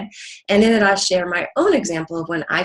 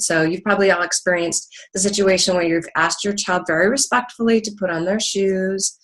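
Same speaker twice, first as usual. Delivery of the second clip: ordinary talk, nothing marked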